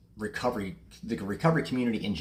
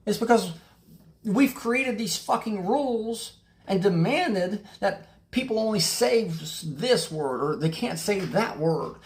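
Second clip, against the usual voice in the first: heated, raised rant; fired up; 9 semitones higher, 4 dB louder than usual. Real, not acted. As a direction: let the frustration boil over as you push the point. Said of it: "Sorry, I'm getting a little fired up".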